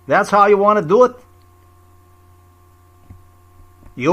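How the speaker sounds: high, forceful confrontation; defiant challenge. Real, not acted. Challenging opponents who portray their own reality, defiant.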